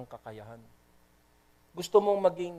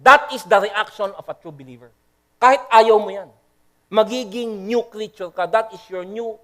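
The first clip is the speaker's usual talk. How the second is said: preaching emphasis; 4 semitones higher, 6 dB louder than usual. Real, not acted. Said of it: "preaching surge".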